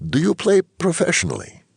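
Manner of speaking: put-on high-pitched doctor with faint Ukrainian accent. A narrator's reading. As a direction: ask in a high voice, lightly Ukrainian. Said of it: "in her faint Ukrainian accent".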